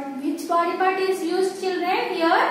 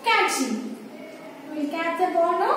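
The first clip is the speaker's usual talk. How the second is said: normal talking voice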